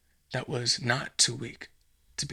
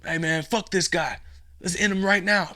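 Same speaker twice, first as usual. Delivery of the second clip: impatient thug; 6 semitones higher, 5 dB louder than usual. Acted. Impatient burglar urges killing.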